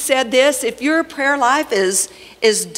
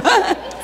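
short burst of laughter; 8 semitones higher than usual. Real, not acted. Nickname joke cracks her up.

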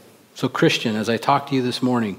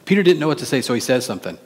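normal talking voice

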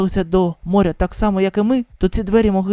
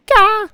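startled yelp; 13 semitones higher, 5 dB louder than usual. Acted. Startled cry.

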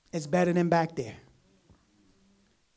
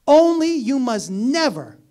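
emphatic; 5 semitones higher, 8 dB louder, slower than usual. Acted. Preacher reading Abraham's charge.

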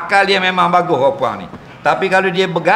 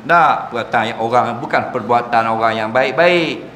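ordinary delivery

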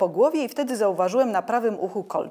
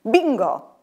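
a bright, louder cry of delight; joyful shout; 4 semitones higher, 6 dB louder than usual. A narrator's reading. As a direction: shout it out in triumph. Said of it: "radośnie wykrzyknęli". (radosny okrzyk)

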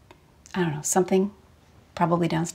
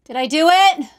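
from another room; 7 semitones higher, 8 dB louder than usual. Acted. Calling from the basement.

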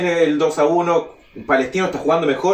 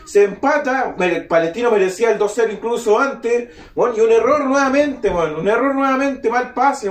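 furious fan; 6 semitones higher than usual. Real, not acted. Fan raging at defensive error.

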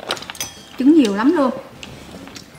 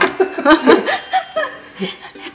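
high laugh; 13 semitones higher than usual. Real, not acted. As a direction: burst of high giggling. Teasing about the small male lobster.

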